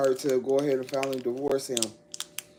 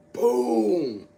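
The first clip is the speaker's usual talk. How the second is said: imitated boom; 8 semitones higher, 3 dB louder than usual. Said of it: "he was like".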